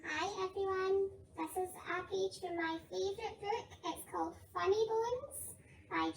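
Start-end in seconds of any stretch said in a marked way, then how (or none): none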